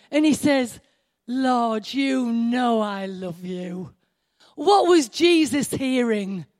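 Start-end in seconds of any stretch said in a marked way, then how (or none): 1.3-3.9 low, slow, drawn-out devotion; tender avowal. Retold or imitated. Peter answers Jesus asking if he loves him, tender. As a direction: answer tenderly, lingering on each word.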